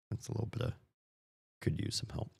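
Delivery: unvoiced whisper under the breath; hushed side comment; whispered aside. Real, not acted. Aside on bad script.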